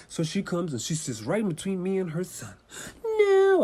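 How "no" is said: put-on high, loud whining child; whiny little boy. A grown man voicing a whining boy at the gate. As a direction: wail like a small kid refusing.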